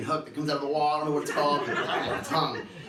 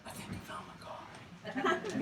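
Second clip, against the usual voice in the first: awed whisper; 12 semitones higher, 8 dB quieter, slower than usual. Retold or imitated. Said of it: "awed realization".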